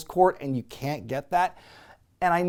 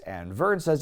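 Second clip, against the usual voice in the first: ordinary delivery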